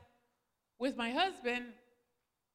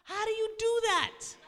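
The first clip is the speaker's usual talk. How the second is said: higher and louder, bewildered; baffled question; 9 semitones higher, 5 dB louder than usual. Retold or imitated. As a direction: ask it in disbelief. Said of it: "And I was like".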